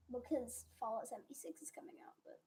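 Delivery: fast whispered aside. Real, not acted. To viewers about a new game, fast whisper. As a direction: whisper it quickly, half to yourself.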